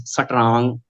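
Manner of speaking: Punjabi "satrang", vowel slipped into strong; Punjabi accent. Shown as a demonstration. Trainer showing mother-tongue influence, Punjabi-accented "satrang". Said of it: "mother tongue is Punjabi".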